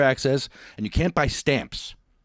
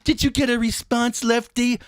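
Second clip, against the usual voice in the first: mocking high voice; 8 semitones higher than usual. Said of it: "I get a thing from you saying".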